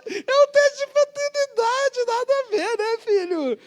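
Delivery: very high, slow and sing-song mother's voice; mother reassures son, very high and slow; fussy mother. Retold or imitated. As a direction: coo it like a mom brushing off worry.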